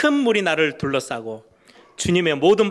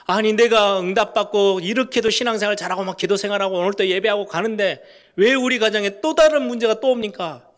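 higher and louder, an aggrieved protest; indignant complaint; 6 semitones higher, 6 dB louder than usual. Retold or imitated. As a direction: protest as if wronged after doing everything right. Imitating a complaining churchgoer. (억울한 성도가 하나님께 높고 크게 항의하듯)

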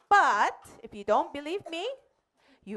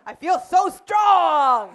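loud, slow, proud declaration; triumphant shout; 3 semitones higher, 8 dB louder, slower than usual. Acted. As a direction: boast to the witch doctor that you're fine. Missionary shows he is unharmed.

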